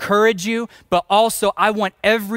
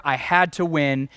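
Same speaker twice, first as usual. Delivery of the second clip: normal talking voice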